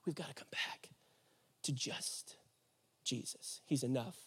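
very soft, low and halting; hushed appeal. Real, not acted. Preacher urges congregation back to just Jesus, hushed. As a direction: drop almost to a whisper, with pauses.

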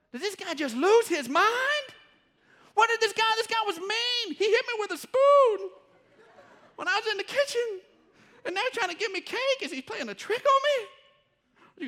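high, loud incredulous helper; can't believe he's offering cake; hammed-up bewildered helper, high and loud; mock disbelief. Helper confused by baker's change.

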